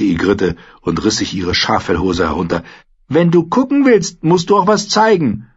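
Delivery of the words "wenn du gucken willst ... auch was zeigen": hohe, freche Frauenstimme der Wildlingsfrau; Ygritte, fordernd. Acted. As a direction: keck und fordernd, deutlich höher gesprochen. Ygritte fordert Jon heraus. (Ygritte, keck und fordernd)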